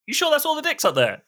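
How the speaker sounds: alarmed high voice. Retold or imitated. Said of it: "And the guy's like".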